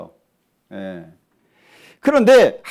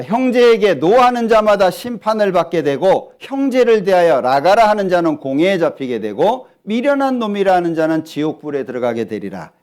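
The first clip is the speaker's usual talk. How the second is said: raised, solemn reading of a verse; scripture reading; 5 dB louder than usual. Acted. Preacher reading scripture to the congregation, solemn and raised. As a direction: read the verse aloud to the congregation. Reads scripture passage aloud.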